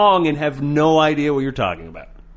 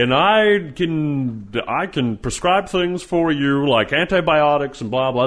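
slow, smug mock quack; drawn-out fake expert; mock naturopath; slower than usual. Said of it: "they'll just pop up and be like".

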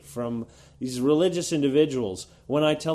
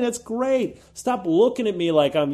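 normal talking voice

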